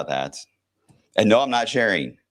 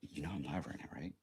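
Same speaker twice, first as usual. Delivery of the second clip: very soft aside to a visitor; real hushed aside; 2 semitones lower, 20 dB quieter, faster than usual. Real, not acted. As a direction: drop way down, almost under your breath. Aside to visitor during live stream.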